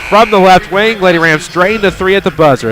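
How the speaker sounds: loud, high buzzer-beater call; excited. Real, not acted. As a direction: yell it as the shot drops. Buzzer-beater three-pointer call.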